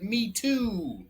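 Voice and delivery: a hint of a small joey voice; baby kangaroo. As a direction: lightly pipe up like a tiny joey. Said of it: "the young kangaroo in her pouch said".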